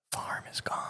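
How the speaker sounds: breathy mock whisper, voice dropped right down. To a chef who still wants produce, a whispered aside. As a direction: hush it like breaking bad news. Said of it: "And I'm gonna be like".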